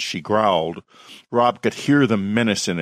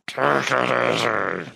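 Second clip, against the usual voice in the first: shouted growl. A narrator's reading. Werewolf Rob pleads with screaming people, in a garbled growl. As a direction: try to shout through a wolf's throat. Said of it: "Rob shouted".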